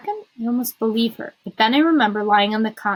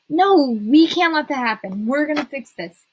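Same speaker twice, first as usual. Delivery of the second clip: higher, louder, emphatic interruption; urgent resolve; 5 semitones higher, 5 dB louder than usual. A narrator's reading. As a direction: cut in determined to fix it. Friend cuts in, resolved to help.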